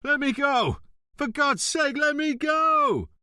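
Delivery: high, loud pleading shout; staged struggle, yelling to be heard outside. Acted. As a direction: scream for help as if being strangled. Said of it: "Montalbano started shouting".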